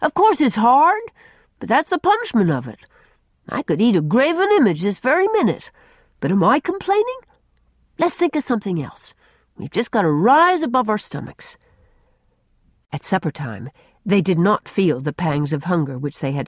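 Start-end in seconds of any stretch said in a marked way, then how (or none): 0.0-11.6 put-on high-pitched bossy boy; emphatic child's pep talk, pitched up; commanding. A narrator's reading. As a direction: scold and rally the others. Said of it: "commanded Jerry".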